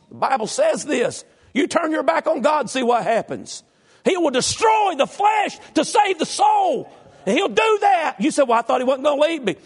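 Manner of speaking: high, loud preacher shouting his point; full-force preaching. Real, not acted. Preacher exhorting the congregation, shouting high and loud. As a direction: preach it at the top of your voice.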